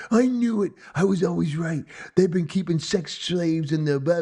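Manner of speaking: high-pitched mock triumph; vindicated ranting. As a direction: imitate a conspiracy believer proved right at last. Acts out vindicated movie character.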